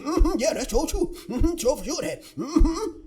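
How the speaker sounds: hammed-up agreeable humming and yessing; mock-sincere "mm-hmm" in a put-on voice; mock agreement. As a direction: play a man falsely agreeing all day. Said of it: "And he can".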